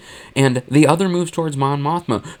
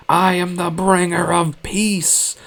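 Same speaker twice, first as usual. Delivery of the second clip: slow, high mock-crazed proclamation; scream; 5 semitones higher, slower than usual. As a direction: draw it out like a zealot gone mad. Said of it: "she screams".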